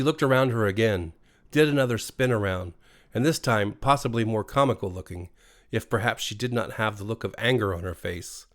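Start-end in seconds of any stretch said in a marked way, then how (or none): none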